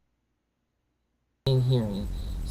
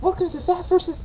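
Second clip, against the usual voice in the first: very high, louder mock announcer; squeaky high intro voice; 5 dB louder than usual. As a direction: squeal the welcome like a cartoon. Silly intro retake, high voice.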